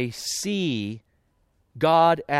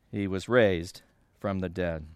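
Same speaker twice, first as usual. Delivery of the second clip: ordinary talk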